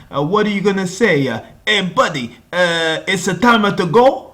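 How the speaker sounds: high, loud, incredulous outburst; loud, high disbelief at his son; outraged father. Retold or imitated. Father outraged gun was pawned.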